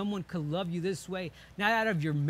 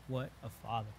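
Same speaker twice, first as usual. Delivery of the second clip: What a Father breathed out much softer and lower, fading to near silence; hushed awe; 7 semitones lower, 9 dB quieter than usual. Retold or imitated. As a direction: let "What a Father" die away in quiet wonder. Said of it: "you want to cry out".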